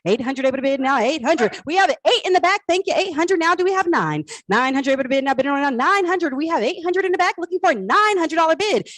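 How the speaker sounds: brisk auction calling, pushing bids; auctioneer. Acted. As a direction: call the bids like a live auction. Runs the auction bidding.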